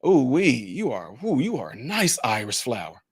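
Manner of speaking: softly cooed praise; sweet compliment. Shown as a demonstration. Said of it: "if I said to you".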